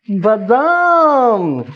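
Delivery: long drawn-out, lingering word; savouring discovery. Acted. Husband finding almonds in the freezer, savouring. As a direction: stretch it out, savouring the find.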